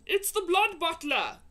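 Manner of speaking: high, urgent alarm; frightened giant naming the threat; alarmed warning. Acted. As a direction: warn of the danger in a rush. BFG warns of giant.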